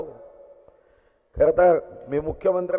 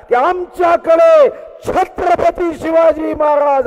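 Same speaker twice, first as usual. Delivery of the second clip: building higher and louder to a proud peak; proud oratory; 6 semitones higher, 3 dB louder than usual. Real, not acted. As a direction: swell with pride toward Chhatrapati Shivaji Maharaj. Proud address to chief ministers.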